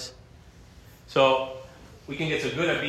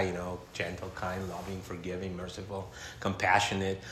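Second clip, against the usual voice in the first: soft, gentle voice; 6 semitones lower, 9 dB quieter than usual. Retold or imitated. Describing soft God.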